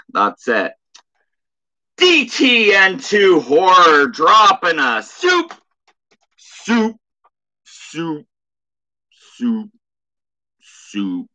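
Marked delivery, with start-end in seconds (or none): 2.0-11.3 high sing-song killer clown, fading out on each soup; creepy clown teasing, higher then sinking away; Tim Curry Pennywise. Acted. Thanking a viewer for a super chat in a high creepy clown voice. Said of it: "Very nice Tim Curry Pennywise impression".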